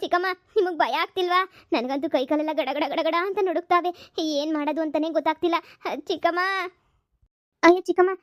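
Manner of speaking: high, trembling fearful pleading; scared girl begging her aunt about the ghost; frightened. Acted. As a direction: fret in fear, still panicking.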